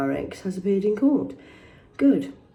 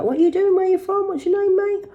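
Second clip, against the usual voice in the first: imitating a stranger; 14 semitones higher, 5 dB louder than usual. Voicing street stranger, high.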